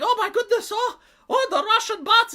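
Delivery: hammed-up alarm at spotting bots; mocking panicked commenter. Streamer mocking Polish commenters, high mock panic. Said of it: "the Polish people are like".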